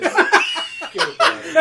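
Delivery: high, loud, slow laughing New York drawl; laughing New York accent. Retold or imitated. Friends trading accents, laughing New York voice. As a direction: ham up a New York tough guy. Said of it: "how about a New York accent?".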